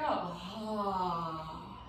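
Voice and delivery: soft exhaled ah, letting go; open mouth sigh. Shown as a demonstration. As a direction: release the breath with a sigh. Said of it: "And then exhale".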